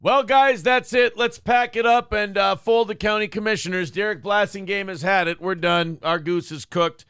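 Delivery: hammed-up official throwing in the towel; mock commissioner. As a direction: call off the meeting in comic defeat. Playing a defeated commissioner folding the board.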